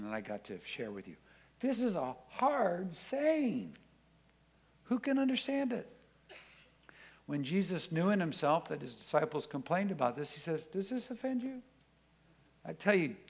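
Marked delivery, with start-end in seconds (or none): 1.6-5.9 high, slow, whiny protest; slow high-pitched incredulous complaint; complaining disciples. Acted. Disciples complaining about a saying. 10.7-11.6 teasing question. Acted. Jesus quoted teasing the disciples.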